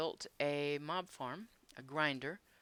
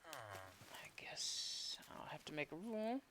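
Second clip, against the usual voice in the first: slow whispered aside to himself; 9 dB quieter, slower than usual. Real, not acted. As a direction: whisper it slowly under your breath. Muttering to himself mid-tour.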